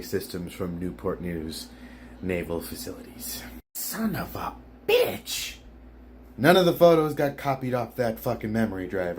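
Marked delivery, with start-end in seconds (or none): 3.7-5.6 exasperated curse. Acted. Slow put-on curse.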